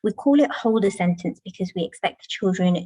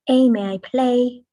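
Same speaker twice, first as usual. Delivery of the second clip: "A" drawn out higher and louder; stressed sound; 5 semitones higher, 5 dB louder, slower than usual. Shown as a demonstration. Teacher highlighting the A sound for the class. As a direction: stretch "A" loud and high.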